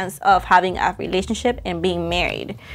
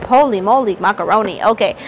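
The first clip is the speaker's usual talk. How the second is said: normal talking voice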